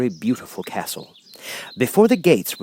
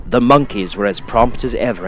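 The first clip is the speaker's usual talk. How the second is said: ordinary delivery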